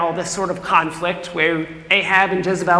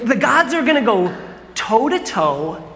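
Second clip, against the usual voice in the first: ordinary talk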